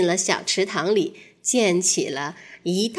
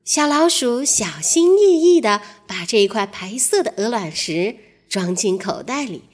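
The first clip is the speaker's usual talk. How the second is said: plain reading voice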